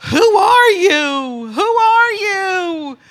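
high, loud, drawn-out wail. Retold or imitated. Young guy demanding to know who she is, loud pleading cry. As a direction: cry it out slowly, stretching every word. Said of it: "Louder and louder".

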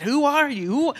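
lightly imitated insistent question. Young guy who kept saying it, mildly insistent. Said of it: "Louder and louder".

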